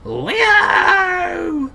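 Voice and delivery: high, cracking, croaky cry; hoarse desperate shout. Acted. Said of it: "My voice shouldn't be this croaky".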